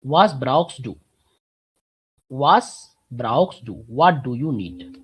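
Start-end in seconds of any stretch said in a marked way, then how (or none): 0.0-3.8 slow, careful, stressing was; „Was brauchst du?“ spelled out slowly; slow model sentence. Shown as a demonstration. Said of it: "you have to add".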